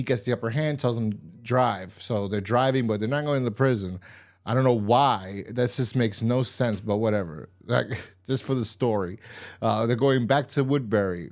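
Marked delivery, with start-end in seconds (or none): none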